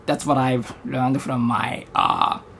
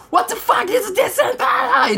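very high-pitched, worked-up squawk; hyped-up comedian rant. Acted. Comedian imitating Bill Burr getting himself hyped up, high-pitched shouted rant. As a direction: blow up in mock outrage, voice shooting way up. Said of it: "hype himself up".

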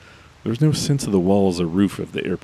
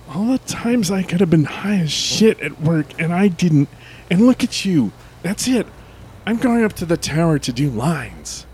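fed up; 11 semitones higher than usual. Acted. Fed-up coworker.

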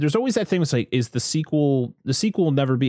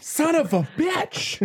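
mock outrage; slower than usual. Real, not acted. Host caught off guard, mock outrage.

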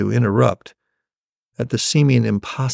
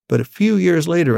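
ordinary delivery, nothing marked

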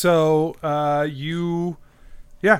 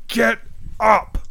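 raised, punched-out command; shouted order; 5 semitones higher than usual. Acted. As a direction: shout it in two separate bursts.

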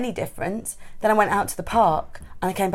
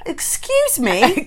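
prim excuse me; 8 semitones higher, much slower than usual. Pretend. Mocking English prim reaction.

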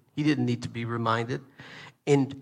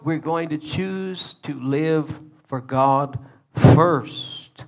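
ordinary talk

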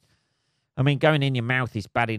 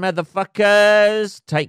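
drawn-out, much higher, much louder yell; cheeky shout; 9 semitones higher, 8 dB louder, slower than usual. Real, not acted. Signing off to listeners, slow cheeky shout. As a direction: belt it out playfully.

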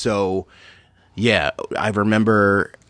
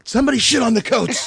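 high yelled comedy bit; imitating a comic's shout; Dane Cook shout. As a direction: belt it like a standup punchline. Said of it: "the old Dane Cook bit".

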